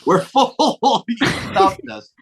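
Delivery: high-pitched words through laughter; laughing retelling. Retold or imitated. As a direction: crack up while quoting yourself. Laughs while retelling their answer.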